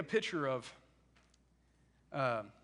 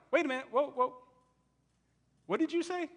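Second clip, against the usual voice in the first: startled double take; 5 semitones higher than usual. Retold or imitated. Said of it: "And so David's like".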